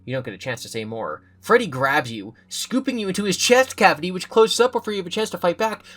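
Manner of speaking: ordinary delivery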